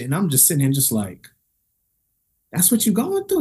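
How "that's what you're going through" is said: high-pitched sympathetic question; incredulous high question; retold disbelief. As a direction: ask it taken aback, pitched up. Shocked response to man's troubles.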